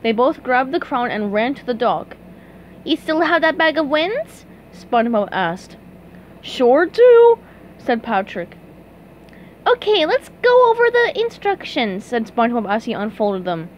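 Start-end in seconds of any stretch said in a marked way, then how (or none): 2.8-4.5 high cartoon character question; SpongeBob asking. A narrator's reading. SpongeBob voiced in story. 6.4-7.4 loud, high cartoon reply; Patrick answering. A narrator's reading. 9.7-12.0 high, eager cartoon voice; SpongeBob voice. A narrator's reading.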